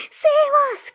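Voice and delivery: high, loud plea for help; frightened cry. A narrator's reading. Terrified grandma begs for rescue, crying out. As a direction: cry out in panic. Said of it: "cried Grandma Josephine".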